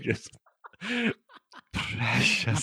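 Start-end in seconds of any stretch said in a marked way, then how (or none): 1.7-2.6 lightly voiced Gollum, hushed. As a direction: breathe it like a hint of Gollum. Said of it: "a proper Gollum".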